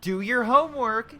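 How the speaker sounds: high, loud, slow mock parent order; imitating a parent telling a kid off. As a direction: bark it like an exasperated mom. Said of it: "like telling a five-year-old".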